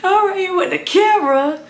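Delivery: high giggly aside to the camera; laughing. Real, not acted.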